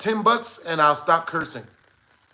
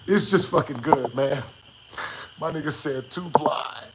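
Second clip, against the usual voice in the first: usual speaking voice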